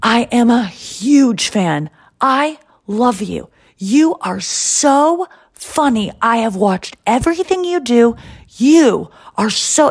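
Jerry praising the comedian, playfully imitated gushing. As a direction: gush over her slowly, wide-eyed. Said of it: "he says something like".

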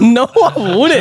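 high, drawn-out plea through laughter; laughing protest. Retold or imitated. Retelling his answer to queasy Sam, high laughing protest. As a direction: stretch out an amused, squeamish refusal.

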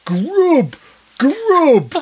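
loud, high Brummie bark of a single word; mock Brummie cameraman begging for food; Birmingham accent. Brummie cameraman asking French couple for food, loud Birmingham accent. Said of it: "in this massive Birmingham accent goes".

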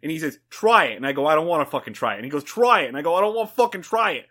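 put-on animated Boston accent tall tale. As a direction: rave about it like a Boston regular. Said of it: "That was a solid Boston".

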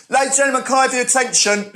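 high-pitched mock public announcement; man addressing crowd. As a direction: call a whole cinema to attention. Imitates man addressing the audience.

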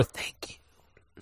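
breathy whispered thank you; whispering. Retold or imitated. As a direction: whisper it, barely audible. Whispered thanks after the sneeze.

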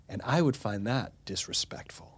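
whispered aside; softly whispered admission. Real, not acted. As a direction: whisper it under your breath. Aside on his own view.

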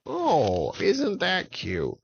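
high mocking witch voice. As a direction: sneer it out like a witch. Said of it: "And the witches said".

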